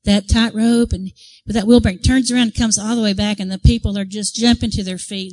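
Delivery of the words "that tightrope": usual speaking voice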